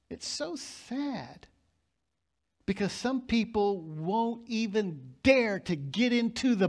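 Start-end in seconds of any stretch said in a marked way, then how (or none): 0.1-1.5 much softer and slow, a hushed sorrowful "It's so sad"; soft sad repeat. Acted. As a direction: let the repeat sink quietly into sorrow. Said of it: "It's so sad. (repeated)".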